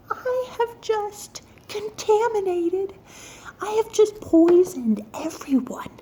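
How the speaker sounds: very high, drawn-out wail; high-pitched, hammed-up dismay; horrified realization. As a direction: wail it out in mock horror. Retold horror at poisoning family.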